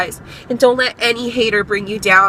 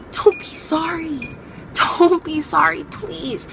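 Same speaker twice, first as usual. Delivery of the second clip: high, soft, mock-weepy plea; tearful pleading; 6 semitones higher, 6 dB quieter than usual. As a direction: plead gently in a small high voice.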